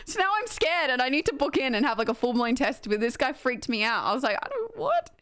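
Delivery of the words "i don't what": very high-pitched, baffled squeak; mock bewilderment. Patient retelling the doctor freaking her out, high mock bewilderment. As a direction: act out stunned disbelief, pitched way up.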